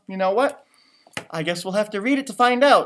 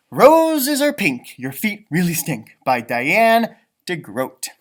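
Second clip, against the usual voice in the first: slow, louder title read; title announcement; 8 dB louder, slower than usual. Acted. Host presenting the book title, slow and loud. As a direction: announce the title grandly and slowly.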